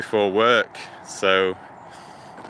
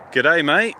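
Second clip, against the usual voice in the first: badly imitated Aussie greeting; terrible Australian accent; 3 semitones higher, 3 dB louder than usual. Walker greeting a viewer in Australia, terrible Australian accent. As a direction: have a poor go at an Australian accent. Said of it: "Sorry about my terrible accent".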